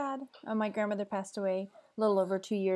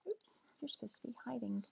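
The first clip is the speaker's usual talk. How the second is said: whisper; 3 semitones higher, 14 dB quieter than usual. Real, not acted. Talking softly to hidden pet.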